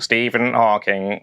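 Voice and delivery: slow, mechanical computer-voice take on the name; a quick mock Stephen Hawking voice; Stephen Hawking impression. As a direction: imitate a speech synthesizer, slowly and evenly. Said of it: "That was my Stephen Hawking impression".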